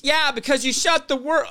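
high yelled interjection, cut off; sudden high-pitched yell; emotional outburst. Real, not acted. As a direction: jump in with an angry accusation. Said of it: "I got emotional there".

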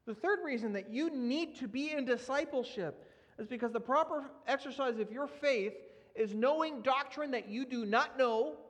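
usual speaking voice

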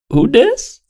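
squeaky high who dis; high mock who dis. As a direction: pitch it way up, teasing. Imitating the reply.

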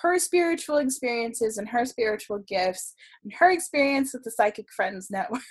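ordinary talk, nothing marked

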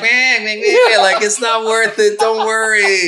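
very high, laughing mock duck voice, slowing at the end; female duck voice. Voicing the female duck soothing her mate, very high and laughing. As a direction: squeak like a calming female duck. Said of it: "the female's like".

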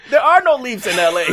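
high-pitched comic protest; mock outrage. Viewer griping about fake L A scenery, mock-outraged.

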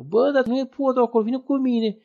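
high, loud insistence; calling out. Retold or imitated. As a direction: show him the bridge, urgently. Stranger redirects him to the bridge.

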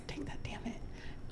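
soft whispered mutter; whispered aside. Real, not acted. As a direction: whisper it under your breath.

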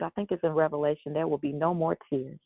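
ordinary delivery

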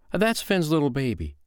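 high, lilting woman's voice; unruffled foster mother. Acted. Foster mother answers giant.

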